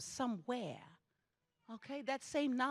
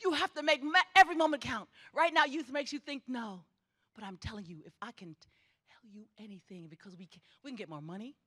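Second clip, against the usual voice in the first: ordinary talk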